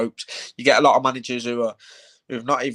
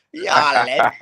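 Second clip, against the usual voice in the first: mock Manc accent; 3 semitones higher, 7 dB louder than usual. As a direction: slip into a playful Manc accent. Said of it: "I'll just start talking Manc".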